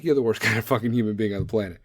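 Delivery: ordinary talk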